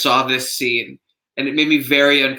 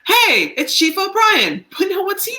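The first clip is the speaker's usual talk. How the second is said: a hint of a cheery O'Brien arriving; playful mock character voice, much higher; 4 dB louder than usual. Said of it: "let's go to Chief O'Brien".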